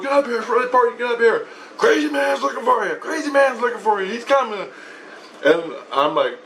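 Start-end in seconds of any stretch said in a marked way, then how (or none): 0.0-4.7 high-pitched urgent calling; urgent warning. Retold or imitated. Warning him a crazy man is coming, high urgent calls. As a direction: imitate a frantic repeated warning, pitched up. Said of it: "Crazy going".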